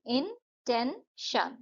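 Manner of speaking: इंटेंशन said very slowly, इन-टेन-शन; syllable beats. Shown as a demonstration. Teacher counts the beats in इंटेंशन. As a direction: stretch इंटेंशन into three beats. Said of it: "इंटेंशन में हो गया तीन".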